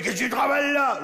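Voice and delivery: put-on high-pitched shout in Champagne accent. Big spider challenging the little male, Champagne accent. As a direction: holler it like a Champagne local. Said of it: "elle dit, la grosse, elle dit".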